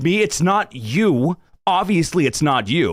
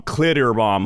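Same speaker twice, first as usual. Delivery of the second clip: ordinary talk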